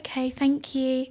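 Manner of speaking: high, slow, sweet little reply; put-on demure politeness; coy thanks. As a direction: draw out a girlish thank-you, imitated. Acting her sweet thanks to helper.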